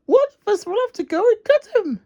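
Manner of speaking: very high, fast squeak; fast, high squeaky mixer voice; squeaky Dizzy. Acted. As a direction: squeak it out in a rush. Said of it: "squeaked Dizzy".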